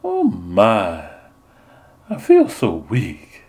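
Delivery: hint of a swooning belle, slowing; fainting belle. Nun as Portia fans herself, lightly swooning. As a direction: sigh it out faintly. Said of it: "She was fanning herself again".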